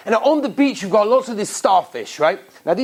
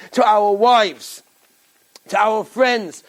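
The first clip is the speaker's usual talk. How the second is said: ordinary talk, nothing marked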